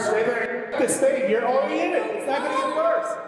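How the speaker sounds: high, rising coach's urging; urgent coaching. Real, not acted. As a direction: push her to hold on, fast and high. Coach urging woman in ice bath.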